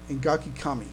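normal talking voice

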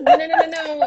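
high laughing no no no; laughing refusal. Retold or imitated. Dodging a friend's hug, laughing refusal. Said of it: "I'm like".

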